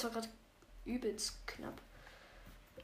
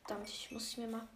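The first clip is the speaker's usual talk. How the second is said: ordinary talk, nothing marked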